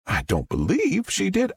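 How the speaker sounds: a Southern mom gushing to the press; Southern witness. Acted.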